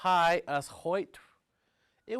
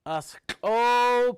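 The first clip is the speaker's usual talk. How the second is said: higher, louder and slow call; yelled command; 7 semitones higher, 9 dB louder than usual. Retold or imitated. Carver calling to the seal to live, slow loud call. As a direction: call it out so it comes to life. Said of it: "He yelled out".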